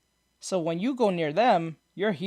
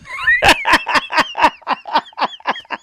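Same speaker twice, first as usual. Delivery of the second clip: high, loud burst of laughter; loud real laughter; 6 dB louder than usual. Real, not acted. Cracking up at his own joke to the friend, loud real laughter.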